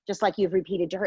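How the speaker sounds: normal talking voice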